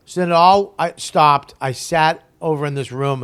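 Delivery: ordinary delivery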